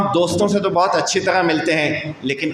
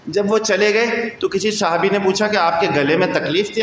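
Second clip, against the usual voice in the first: ordinary talk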